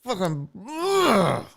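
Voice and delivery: holding back rage, words breaking off; mock strangled fury with grunts; acted restrained anger. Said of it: "pull it back and be like".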